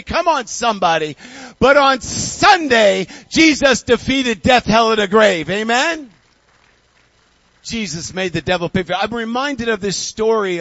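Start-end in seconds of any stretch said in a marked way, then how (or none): 1.6-6.1 loud, slow triumphant proclamation; fiery preaching. Acted. A preacher proclaiming Easter victory, loud and triumphant.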